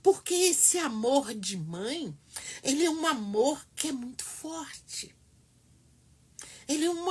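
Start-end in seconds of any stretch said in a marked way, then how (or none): none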